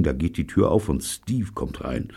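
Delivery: ordinary delivery, nothing marked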